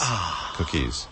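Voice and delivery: mock-disappointed murmur of "Cookies"; deflated cookies. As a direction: let "Cookies" sag away under your breath. Echoing the cookie joke, dropping it.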